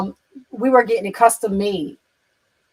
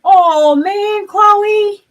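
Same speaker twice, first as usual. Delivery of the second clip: surprised delight; 10 semitones higher, 6 dB louder, much slower than usual. Real, not acted. Sees a viewer she knows.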